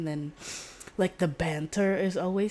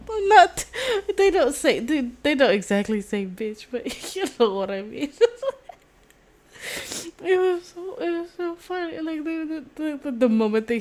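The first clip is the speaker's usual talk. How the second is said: high, giggly and louder; laughing; 11 semitones higher, 6 dB louder than usual. Real, not acted. Vlogger correcting herself while still laughing.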